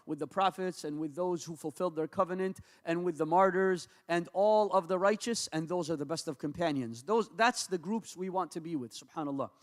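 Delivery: ordinary talk, nothing marked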